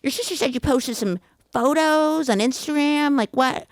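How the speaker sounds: playing the puzzled mother; mom's voice. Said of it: "And she goes".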